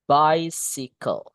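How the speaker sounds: BICYCLE slowly, "BAI-si-kol"; model pronunciation. Shown as a demonstration. Teacher showing how it is read, slow drawn-out BICYCLE. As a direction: stretch out BICYCLE, short "si" in the middle. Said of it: "Ingat bacanya gini".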